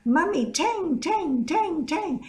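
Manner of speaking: put-on a small boy pestering his mum for a drink; begging little boy. Mother voices her begging boy.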